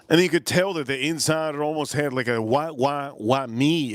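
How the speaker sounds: usual speaking voice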